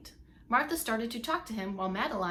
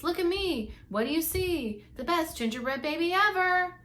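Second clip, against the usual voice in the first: higher, louder showing-off chant; loud proud sing-song; 7 semitones higher, 4 dB louder than usual. Acted. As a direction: belt it out like he owns the river. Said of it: "singing in a loud voice".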